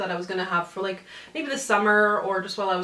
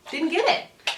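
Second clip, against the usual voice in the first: mock indignant; 8 semitones higher, slower than usual. Real, not acted. Punchline repeated after whisper.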